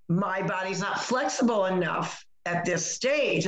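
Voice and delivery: ordinary talk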